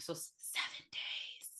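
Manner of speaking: slow eerie whisper. Acted. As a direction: breathe it out ominously. Whispered ominous count.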